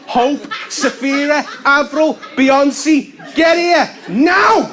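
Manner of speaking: high, loud shouting down the street; mum bellowing names. Retold or imitated. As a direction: yell the whole list of kids' names. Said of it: "And she'll be going".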